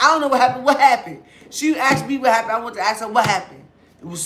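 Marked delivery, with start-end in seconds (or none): 0.0-1.2 mocking drunk voice. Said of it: "I really felt like".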